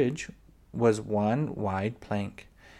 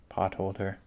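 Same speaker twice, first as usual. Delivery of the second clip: plain reading voice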